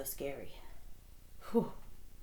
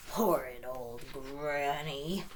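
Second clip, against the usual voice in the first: growling wolf; 4 semitones lower, 3 dB quieter, slower than usual. Acted. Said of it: "the wolf growled".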